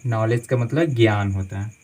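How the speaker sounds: ordinary delivery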